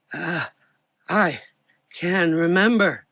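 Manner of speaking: put-on high old voice; old man remembering. A narrator's reading.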